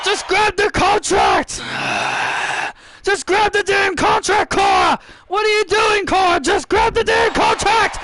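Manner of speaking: high-pitched, pleading shout; exasperated urging. Real, not acted. Commentator begging Cora to grab the contract, exasperated shout. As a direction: yell at her in frustrated disbelief.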